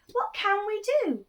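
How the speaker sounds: lightly voiced anxious villagers; worried villagers. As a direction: ask it as a fretting crowd. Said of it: "they said".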